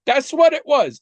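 high, loud, pointed agreement; emphatic confirmation. Real, not acted. Host confirms cohost's guess, high and loud. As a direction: land it hard and high, yes exactly.

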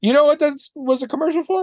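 high, fast, loud rhetorical question; teasing reveal. Real, not acted. Host teases cohost before the reveal, high and fast.

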